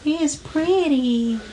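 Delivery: put-on soft, high old lady voice; wispy granny voice. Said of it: "This is [name]'s new podcast voice".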